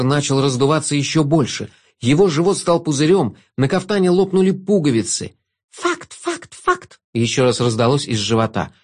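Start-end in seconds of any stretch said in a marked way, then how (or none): 5.7-7.0 squeaky voices. A narrator's reading. Voices from belly.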